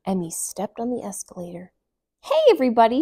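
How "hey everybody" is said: higher, loud shout. A narrator's reading. Emmy shouting from the escalator, high and loud. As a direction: shout out to everyone. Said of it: "she shouted".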